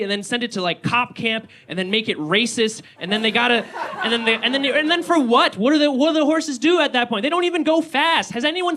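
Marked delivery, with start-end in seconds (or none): none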